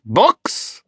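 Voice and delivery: high, rising one-word question; intrigued upward lilt; curious. A narrator's reading. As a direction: ask with piqued curiosity.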